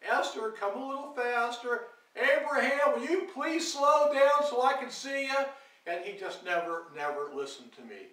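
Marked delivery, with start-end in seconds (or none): 0.0-5.4 raised, higher storytelling call; retold yelling. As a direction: call out to kids riding bikes, half yelling. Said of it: "I'd be yelling".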